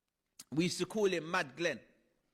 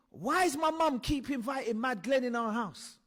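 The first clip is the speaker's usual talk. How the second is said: put-on indignant child; 7 semitones higher, 4 dB louder than usual. Child's inner thought.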